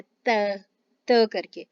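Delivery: त with tongue on the teeth, soft and unaspirated; soft Spanish t. Shown as a demonstration. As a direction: say त, not English T. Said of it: "इसकी आवाज़ त करके निकलती है".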